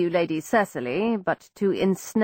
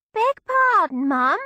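put-on Cockney girl; 11 semitones higher, 3 dB louder, slower than usual. Peggy answers in Cockney.

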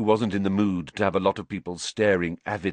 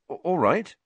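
awkward; 10 semitones higher than usual. Acted.

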